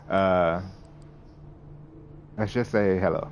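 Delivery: ordinary talk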